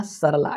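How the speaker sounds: सरला as सर-ला, with no "a" after र; सरला said as two beats, "sar-la"; no vowel after र. Shown as a demonstration. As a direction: run the र of सर straight into ला. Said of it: "र के बाद जो है अ का उच्चारण नहीं है".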